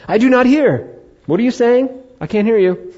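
high, loud, mock-confused; playing deaf, hand to ear, hammed-up; mock deaf man. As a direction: pretend you cannot hear a thing.

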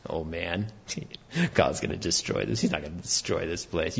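low, soft, dismissive scoff through a laugh; scoffing sons-in-law. Acted. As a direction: brush off the warning with a chuckle.

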